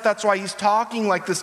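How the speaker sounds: ordinary talk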